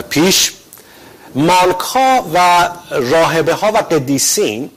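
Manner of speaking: loud, driving sermon delivery; full-force preaching. Acted. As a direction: preach it out to the whole hall. (موعظهٔ پرشور و بلند)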